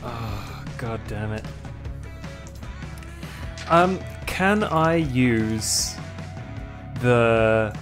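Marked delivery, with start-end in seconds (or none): none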